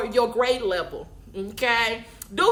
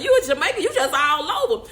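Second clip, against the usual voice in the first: amused teasing; 2 semitones higher, 4 dB louder than usual. Retold or imitated. Speaker retelling her tease to a pastor friend, laughing. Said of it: "I said".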